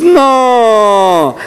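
long, high drawn-out "No"; retold disbelief. Speaker reenacts deciphering the sticker, drawn-out disbelieving no. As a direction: stretch the word out high, then let it slide down. Retelling realisation on deciphering calligraphy.

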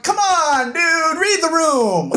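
high, whiny scolding; exasperated protest. Acted. Acted exasperation at a character.

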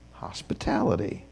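Hospitality breathed out as a whisper; whispered word. Shown as a demonstration. Repeating the keyword in a whisper.

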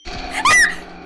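very high, loud shriek of fright; piercing scream. Real, not acted. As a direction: scream at a jump scare. Killer appears in the car.

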